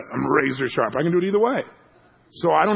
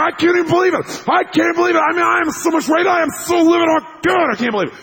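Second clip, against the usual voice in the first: imitated livid rant, high and loud; enraged rant; 7 dB louder than usual. Buddy losing it over bad news, enraged rant. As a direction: blow up like a man in a rage.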